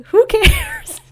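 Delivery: high, loud squeaky who cares; mocking dismissive Merrick. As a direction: toss it off like it means nothing. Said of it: "Merrick says".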